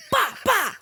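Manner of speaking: high, abrupt "bah" yelps; retold video noise. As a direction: yelp two high bahs as in the video. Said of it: "I was going".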